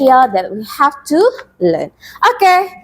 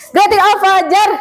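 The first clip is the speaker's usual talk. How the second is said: quick, higher-pitched call to the class; fast class call; 6 semitones higher, faster than usual. Shown as a demonstration. Teacher cueing pupils to chant faster, fast call. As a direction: rattle the class name off fast. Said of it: "If [name] say it faster".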